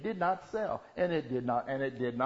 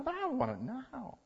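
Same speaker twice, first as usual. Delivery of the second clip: put-on childish demand; 10 semitones higher, 5 dB quieter than usual. Childish demand made to God.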